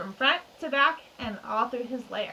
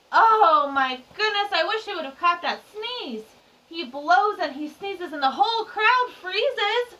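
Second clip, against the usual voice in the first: surprised; 7 semitones higher, 7 dB louder than usual. Acted. Reacts to the sneeze.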